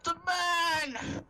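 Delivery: high, loud shouted attack command; battle shout. Acted. Player shouts to their frogs to attack, battle shout. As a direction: bellow the order, pitch leaping up. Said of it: "I shout to my frogs".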